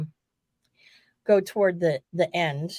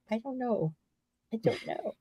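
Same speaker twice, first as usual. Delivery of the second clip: sheepish mumble; 3 semitones higher, 9 dB quieter than usual. Retold or imitated. Embarrassed reply to Marty.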